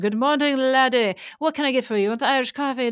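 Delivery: lightly put-on Irish brogue, slightly hammed; Irish accent. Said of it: "one of the best Irish accents I've ever had".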